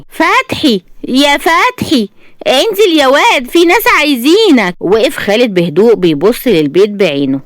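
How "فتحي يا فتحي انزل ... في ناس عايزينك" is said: higher calling voice; calling out loud. A narrator's reading. Old woman calling her son down to meet visitors. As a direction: call up the stairs to her son. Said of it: "تنادي بصوت عالي".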